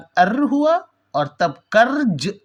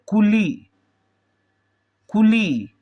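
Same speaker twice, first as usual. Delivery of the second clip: कुली with कु said quickly and ली stressed; 2 semitones higher, slower than usual. Shown as a demonstration. Teacher demonstrating Quli to students, stress on ली. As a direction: rush कु, then lean on ली in Quli. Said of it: "ली पर stress दे रहे हैं".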